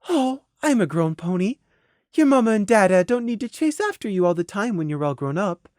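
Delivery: composed lady. Acted. Mare hiding hurt from a child, sweetly composed. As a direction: explain gently to a little one. Mare holds it together, talking down.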